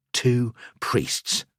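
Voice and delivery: usual speaking voice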